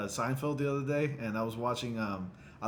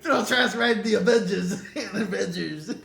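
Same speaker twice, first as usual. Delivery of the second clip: high, loud mimicry breaking into laughter; trash man character; 7 semitones higher, 6 dB louder than usual. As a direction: echo the trash man, cracking up. Echoes the trash man impression, laughing.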